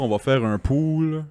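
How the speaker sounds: ordinary talk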